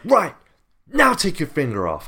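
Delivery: high, loud curt order; mock officer command. Retelling the officer ordering him to redo the prints, high mock bark. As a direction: bark it like a bored border guard.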